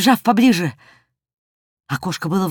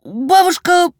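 очень высокий детский голос; оправдываться высоко, как краснеющий мальчишка; смущённый мальчик; 12 semitones higher than usual. A narrator's reading. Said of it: "ещё сильнее покраснел Жорик". (смущённо, по-детски высоко)

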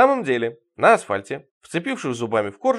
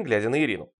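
ordinary delivery, nothing marked

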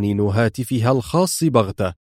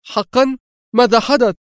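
much higher, startled cry; alarmed exclamation; 12 semitones higher than usual. Acted. Laura hearing the plan went wrong, high alarmed cry. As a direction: jolt upright in alarm. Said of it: "هاتفة في انزعاج".

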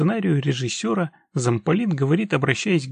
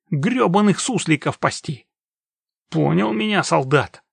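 angry tone; 3 semitones higher than usual. Acted. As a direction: bark at him like an enraged officer. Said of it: "продолжил доверительно злым тоном".

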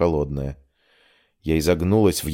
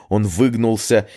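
ordinary delivery, nothing marked

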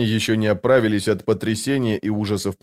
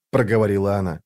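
ordinary delivery

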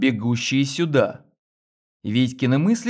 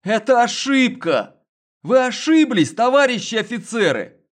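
high frantic protest; desperate pleading; 10 semitones higher, 4 dB louder than usual. A narrator's reading. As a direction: plead wildly for mercy. Detained man pleading.

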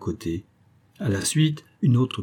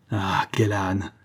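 slow, drawn-out groan of dismay; dismayed self-reproach; much slower than usual. Acted. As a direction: curse your own stupidity slowly. Husband striking his forehead in dismay.

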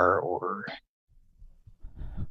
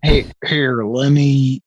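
louder quoted "here, let me"; lightly voiced teacher; mock teacher threat; 3 semitones higher, 6 dB louder, slower than usual. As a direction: play the old teacher's threat. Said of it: "a reference that we would make".

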